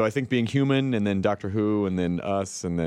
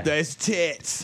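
high, silly put-on announcer; hammed-up promo line; goofy voice, pitched up; mock promo voice; 4 semitones higher than usual. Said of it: "That's what that voice is".

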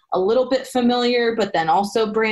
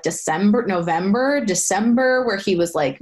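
ordinary delivery

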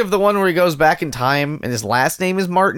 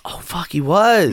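whispered; slower than usual. Real, not acted. Stunned realization, whispered.

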